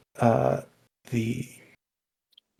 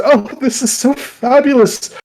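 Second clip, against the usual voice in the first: much higher, louder gush of delight; 10 semitones higher, 6 dB louder than usual. Acted. Suitor overjoyed as she takes the ring. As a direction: squeal it with joy.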